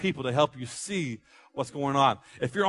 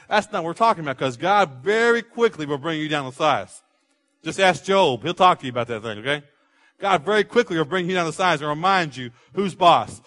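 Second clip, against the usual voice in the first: normal talking voice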